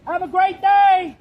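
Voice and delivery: loud, drawn-out sarcastic goodbye; 1 mocking farewell. Real, not acted. Activist taunting the senator as she leaves, loud sarcastic farewell. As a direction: belt it slowly as a sneer.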